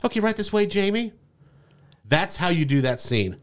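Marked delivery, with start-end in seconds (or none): 0.0-1.1 high-pitched, breezy usher-along; mock front-desk voice, pitched up; imitated receptionist. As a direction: play the receptionist calling him through. Acting out receptionist's brush-off.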